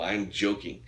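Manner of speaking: ordinary talk, nothing marked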